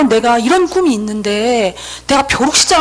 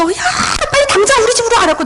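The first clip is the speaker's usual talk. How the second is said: high, rushed tearful urging, acted out; mock bawling; 11 semitones higher, faster than usual. Telling the friend to come home now, mock bawling. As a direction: urge it as if crying hard. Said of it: "막 엉엉 울면서".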